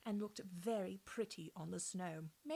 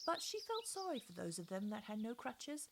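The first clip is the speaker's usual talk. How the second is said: ordinary delivery, nothing marked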